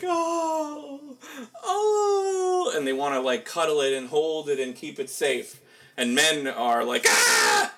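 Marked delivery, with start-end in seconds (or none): 0.0-2.7 very high, swooping coo of a doting woman; cooing mother. Retold or imitated. Mimicking women melting over a crying baby, very high coo. 7.1-7.7 low, loud gruff yelp; mock startled yell. Mimicking men startled by a crying baby, low loud yell.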